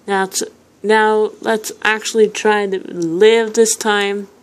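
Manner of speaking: usual speaking voice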